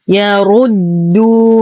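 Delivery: يردونكم slowly, heavy ر with a full rounded u. Shown as a demonstration. Teacher demonstrates slowly, heavy ر in يردونكم. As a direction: thicken ru in يردونكم, draw out duu. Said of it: "نفخم الراء مع إتمام الضم".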